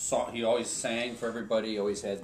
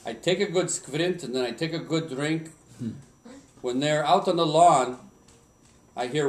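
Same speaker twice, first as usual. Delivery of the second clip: light Swedish dialect, louder storytelling; light Swedish accent, rising to the fun parts; 3 semitones higher, 4 dB louder than usual. Retold or imitated. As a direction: keep the Swedish lilt going. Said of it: "in my best Swedish accent".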